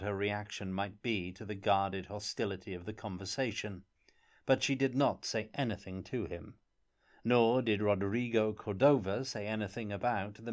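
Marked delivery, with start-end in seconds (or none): none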